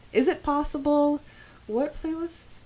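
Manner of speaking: ordinary talk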